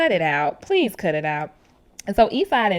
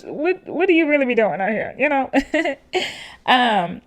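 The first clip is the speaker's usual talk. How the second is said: normal talking voice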